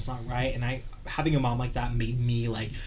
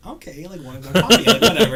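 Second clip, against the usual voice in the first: high, laughing mock dismissal, getting much louder; mock agreement; 7 semitones higher, 12 dB louder than usual. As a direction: laugh off the manager's claim in a raised voice.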